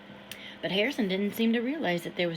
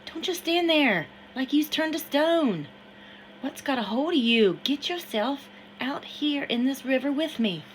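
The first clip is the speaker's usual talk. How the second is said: put-on higher, louder voice of an excited boy in the river; excited Harrison; 6 semitones higher, 5 dB louder than usual. Harrison urges Samuel into the river, higher and louder. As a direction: urge him in, wild and eager.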